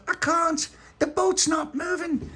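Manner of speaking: squeaky little voice, slow and worried; child character pleading in a high voice. Acted. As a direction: play a small upset child.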